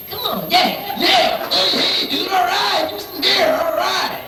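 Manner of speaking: very high, shouted party whoops; mock overexcited dance-floor cheering.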